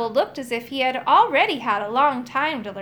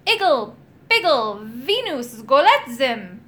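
loud incantation; shouted spell; 4 dB louder than usual. A narrator's reading. Magician shouting a spell while waving his wand. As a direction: bellow the magic words. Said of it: "he shouted".

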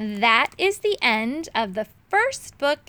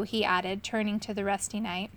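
ordinary delivery, nothing marked

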